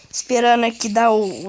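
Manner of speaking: usual speaking voice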